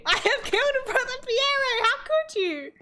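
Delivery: dramatic character voice. Pretend. Player voices monks reacting to a killing, hammed-up. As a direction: ham up the horror of the confession. Voicing game dialogue dramatically.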